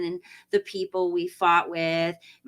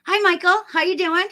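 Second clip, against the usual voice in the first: bright greeting; 11 semitones higher, 6 dB louder than usual. Real, not acted. Greeting a viewer excitedly.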